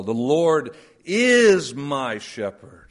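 is stressed, slow; slow reading with is emphasized. Shown as a demonstration. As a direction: dwell on is. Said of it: "It works well in verses in the Psalms".

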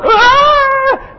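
high sharp imitated cry; sudden high mock child's cry; child's wail. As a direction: wail like a child who won't stop. Child keeps crying loudly.

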